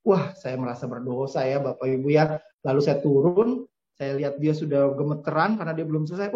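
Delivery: ordinary talk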